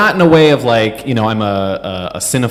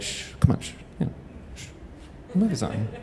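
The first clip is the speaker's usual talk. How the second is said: hushed, acted cinema whisper; gentle shushing; 3 semitones higher, 13 dB quieter, faster than usual. Timid moviegoer shushing a talker, gently whispered. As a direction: shush softly, barely voiced. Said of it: "like, gently, I'm just like".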